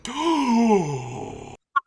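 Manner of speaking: loud, high shocked gasp; sharp gasp. Real, not acted. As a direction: suck in air in surprise. Shocked at lookalike.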